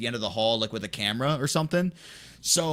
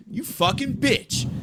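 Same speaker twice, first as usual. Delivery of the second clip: slow, high-pitched accusation; mock outrage; 10 semitones higher, slower than usual. Prankster confronting Phil who snitched, drawn-out mock outrage. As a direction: draw out the insult, high and indignant.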